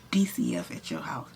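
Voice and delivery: whispered urgent warning; hushed whisper. Retold or imitated. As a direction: whisper it like a secret. Son whispering news on phone.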